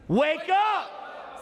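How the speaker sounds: high ringing call to wake; booming motivational speech. Acted. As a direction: lift the crowd with it. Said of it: "Say that with me".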